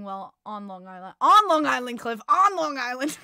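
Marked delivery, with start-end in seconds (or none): 1.2-2.2 cheering the player's new team; excited shout. Real, not acted. Excited shout about favorite player. 2.3-3.2 high, loud, laughing yell; laughing shout. Real, not acted.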